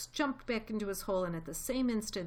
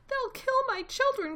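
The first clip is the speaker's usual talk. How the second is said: high, loud anguished cry; mother fox crying out for her children; crying out in fear; 8 dB louder than usual. A narrator's reading. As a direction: wail it in terror for the kids. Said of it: "cried Missus Fox".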